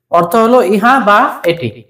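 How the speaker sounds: usual speaking voice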